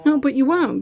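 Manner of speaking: ordinary delivery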